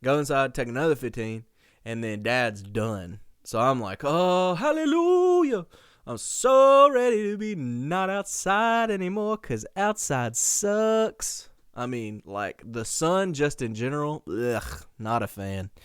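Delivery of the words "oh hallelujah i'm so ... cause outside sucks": loud, high, drawn-out rejoicing; exaggerated relief. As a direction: wail it out in mock-dramatic relief. Exasperated relief when chores are done.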